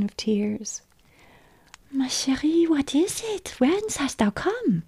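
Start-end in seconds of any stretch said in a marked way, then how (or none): 1.9-4.8 high-pitched, anxious questioning; alarmed mother. A narrator's reading. Mother to her tearful child at the door, alarmed. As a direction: ask in a mother's raised, worried voice. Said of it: "asked the good mother, alarmed".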